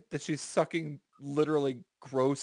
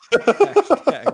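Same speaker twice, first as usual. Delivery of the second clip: laughing; 10 dB louder than usual. Real, not acted. Laughing squeal.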